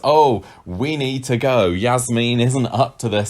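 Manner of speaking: imitating someone making a big show of leaving; theatrical announcement. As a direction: announce it grandly to the whole room, drawing it out. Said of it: "He kind of very theatrically says".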